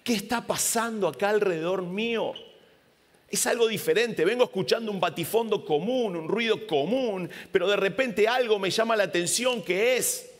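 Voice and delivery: ciego intrigado, más agudo; Bartimeo intrigado. Acted. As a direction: preguntar intrigado qué pasa alrededor. (Bartimeo intrigado, voz más aguda)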